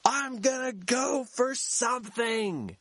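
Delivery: high slow announcement; announcing to everyone. Retold or imitated. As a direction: declare it to the crowd below. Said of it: "I announced to everybody".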